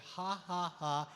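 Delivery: teacher shows the crowd hearty heart laughter, ha ha ha; hearty laugh demo. Shown as a demonstration. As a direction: open chest ha ha ha. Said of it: "hearty laughter".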